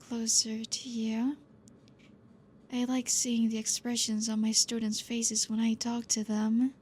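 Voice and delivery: usual speaking voice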